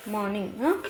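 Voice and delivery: ordinary talk, nothing marked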